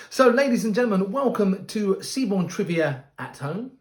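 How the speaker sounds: big bright showman welcome; announcer voice. Acted. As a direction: boom it out like a cruise host. Cruise host opens the show.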